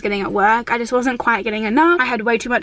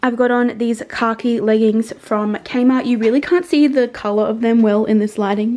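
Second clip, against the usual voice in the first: normal talking voice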